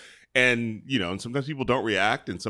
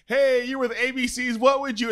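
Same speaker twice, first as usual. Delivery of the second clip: bright, much higher, eager call-out; chipper host greeting; 4 dB louder than usual. Retold or imitated. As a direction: play the cheerful TV host popping out. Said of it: "So I walk out like".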